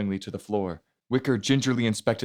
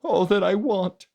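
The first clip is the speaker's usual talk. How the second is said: high, slow, louder sob through the words; sobbing; 11 semitones higher, 4 dB louder than usual. Acted. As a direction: sob it out slowly over him. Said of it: "She sobbed".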